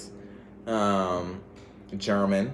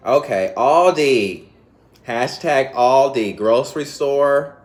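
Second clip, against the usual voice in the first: loud, slow hashtag call-out; loud, drawn-out shout-out; hyped announcing; 5 semitones higher, 8 dB louder, slower than usual. Real, not acted. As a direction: belt the store name like an ad.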